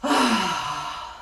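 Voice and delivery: long tired sigh out; falling sigh; heavy sigh. Real, not acted. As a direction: let out a weary sigh. After near tears.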